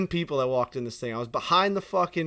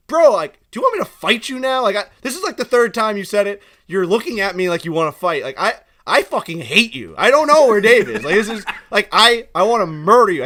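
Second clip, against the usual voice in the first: loud, higher-pitched mock outburst; acted exasperated anger; 5 semitones higher, 9 dB louder than usual. Worker snapping back at a rude customer, hammed-up anger. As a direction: play up being at the end of your rope. Retelling his heated reply to customer.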